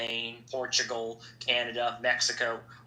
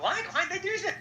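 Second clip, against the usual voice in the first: squeaky, much higher, laughing through it; mock high-pitched laughing question. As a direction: play dumb in a high laughing voice. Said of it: "I was like".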